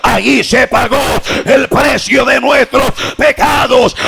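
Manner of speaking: preacher's forceful proclaiming shout; impassioned cry. Acted. Preacher voicing Paul's cry at the cross, shouting fervently. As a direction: cry it out in heavy, punched phrases. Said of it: "él está gritando".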